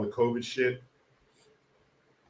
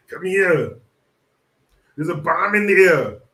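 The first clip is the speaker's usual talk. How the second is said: louder, higher hammed-up Austrian shout; mock Austrian accent; 5 semitones higher, 8 dB louder than usual. Action hero warning about a bomb, mock Austrian accent. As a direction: yell it in a put-on Austrian accent. Quotes film in Schwarzenegger voice.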